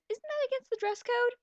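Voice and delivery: ordinary talk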